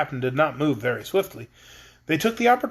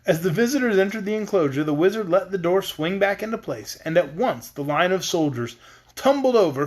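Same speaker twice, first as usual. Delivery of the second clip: ordinary delivery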